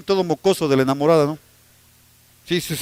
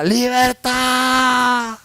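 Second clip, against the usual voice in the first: drawn-out cry of freedom; 4 semitones higher, much slower than usual. Retold or imitated. Preacher retelling William Wallace's last cry, stretched out. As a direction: stretch Libertad out slowly on one breath. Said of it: "coge todo el aire que tiene y grita".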